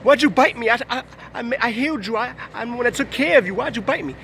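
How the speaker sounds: shocked outrage. Retold or imitated. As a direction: play the bitten woman, stunned and hurt. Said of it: "and she was like".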